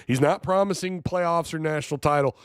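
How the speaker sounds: usual speaking voice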